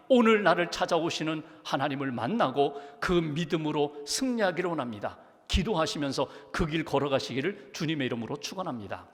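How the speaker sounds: usual speaking voice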